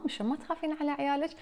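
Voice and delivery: normal talking voice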